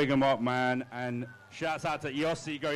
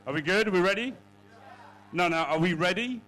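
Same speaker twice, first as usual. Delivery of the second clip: raised, rising questions to a crowd; hyping the crowd; 4 semitones higher, 5 dB louder than usual. Real, not acted. Host asks the crowd to get ready to scream, hyping them up. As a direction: call out to rouse an audience.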